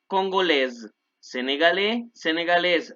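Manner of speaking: ordinary delivery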